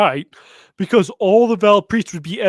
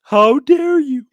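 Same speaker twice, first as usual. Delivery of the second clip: slow, high, hammed-up "how dare you"; mock outrage, drawn out; mock indignation; 7 semitones higher, slower than usual. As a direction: act offended in a silly way. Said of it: "And then he's like".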